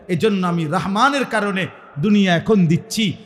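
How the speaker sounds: ordinary talk, nothing marked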